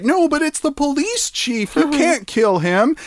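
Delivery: high-pitched pleading objection; mock protest. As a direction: imitate a crowd's alarmed protest. Crowd protesting, retold.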